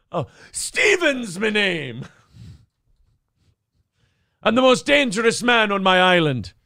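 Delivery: Stephen. Acted. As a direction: introduce yourself as a film Irishman. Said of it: "That's my friend, Irishman".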